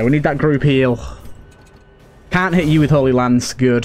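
ordinary delivery

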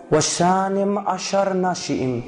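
و شانم اشر نشیم read slowly, اشر held longest; slow Hebrew reading. Shown as a demonstration.

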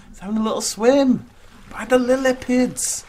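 high, loud gushing over a cute animal; cutesy cooing. Real, not acted. Player watching an animal having a little swim, high cutesy cooing. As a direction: coo it high, drawing out the end.

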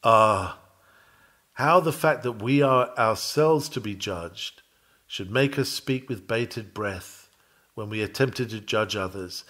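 normal talking voice

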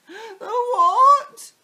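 high, loud shrieked question; panicked scream. A narrator's reading. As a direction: shriek in alarm. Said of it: "screamed Missus Twit".

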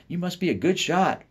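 mother's friend admiring the turkey, high and loud; gushing lady. Acted. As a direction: coo over the bird in a high lady voice. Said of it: "she said".

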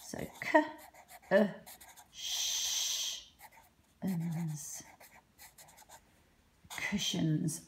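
cushions split into k, uh, sh, uns; quiet segmented sounds, then blend; sounding out. Shown as a demonstration. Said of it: "the uh, making the uh sound in cushions".

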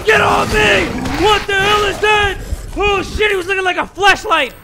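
very high, loud screaming words; panicked shouting. Real, not acted. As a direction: scream it while being grabbed. Panic under attack.

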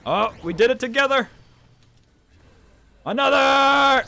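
high loud triumphant yell; excited shout. Real, not acted. Player cheering a repeated clash. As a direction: cheer as it happens again.

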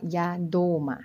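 ยาโดมะ said slowly, syllable by syllable; slow foreign phrase. Shown as a demonstration. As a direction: sound out ยาโดมะ slowly for learners. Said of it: "เราจะบอกว่า". (ออกเสียง ยาโดมะ ช้าๆ)

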